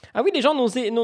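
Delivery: ordinary talk, nothing marked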